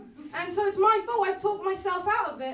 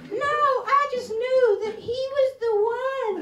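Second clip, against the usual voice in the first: higher, slow, swooning mock lovestruck voice; lovestruck friend; 7 semitones higher, 6 dB louder, slower than usual. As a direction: swoon like a friend newly in love. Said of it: "They go".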